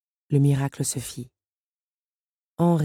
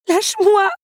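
much higher, loud and desperate; struggling cry; 7 dB louder than usual. Acted. Belle struggles against Henri, crying out. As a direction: fight him off with the voice. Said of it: "se débattit la jeune femme".

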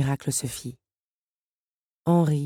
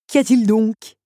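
mocking, higher and louder; ironic; 6 semitones higher, 7 dB louder than usual. Henri mocks Belle on her knees, ironic. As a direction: sneer the question. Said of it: "ironisa-t-il".

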